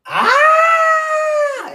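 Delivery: long high-pitched war scream; a Banshee-style shriek cut short. Retold or imitated. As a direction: let out a piercing battle shriek. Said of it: "He's like".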